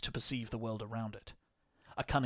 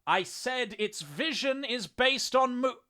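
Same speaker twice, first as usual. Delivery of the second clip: loud, slow repeat with exasperation; exasperated shout, each word drawn out; exasperated measured patience; 11 semitones higher, 5 dB louder, slower than usual. A narrator's reading. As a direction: spell it out slowly in a raised, high voice. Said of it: "a tinge of exasperation coloring his words".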